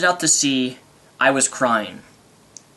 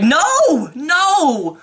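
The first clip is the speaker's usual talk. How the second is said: retold cries of no, much higher and louder; anguished no; 12 semitones higher, 3 dB louder than usual. Retelling the film's ending to viewers, an anguished mock cry. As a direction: protest the hero's death in mock anguish. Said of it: "I was like".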